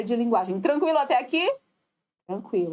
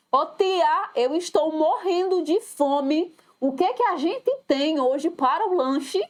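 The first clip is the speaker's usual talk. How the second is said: higher-pitched, exaggerated pleading to an adult; hungry child's plea; 5 semitones higher than usual. Child to the school lunch lady, exaggerated hungry plea. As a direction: play a child complaining of hunger. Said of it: "na escola a tia da merenda".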